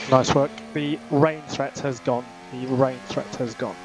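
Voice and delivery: crackly team radio transmission; race car radio. Acted. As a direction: talk like over F1 pit radio.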